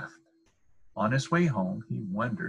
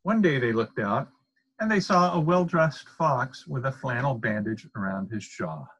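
ordinary delivery, nothing marked

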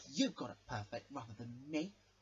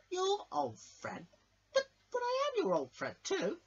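indignant squeak; 7 dB louder than usual. Acted. Crash protests to George, high indignant squeak. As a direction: squeak out the betrayal.

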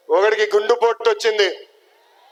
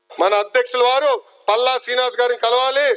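ordinary talk

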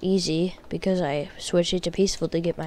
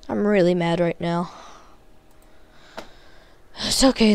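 ordinary talk

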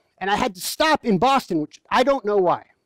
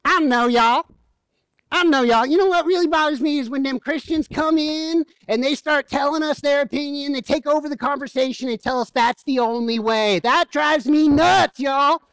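Southern waitress; 5 semitones higher than usual. Retold or imitated. Preacher imitating a Southern waitress, thick Southern accent. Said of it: "this is exactly how she sounded".

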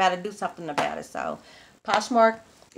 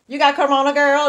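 high, loud teasing question; high nosy girlfriend; 7 semitones higher, 8 dB louder than usual. Pretend. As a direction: ask it like a nosy best friend. Acts out phoning a friend.

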